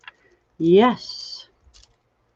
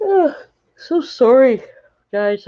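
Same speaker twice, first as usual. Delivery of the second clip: yawning; 8 semitones higher, 3 dB louder than usual. Real, not acted. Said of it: "I apologize for the yawning".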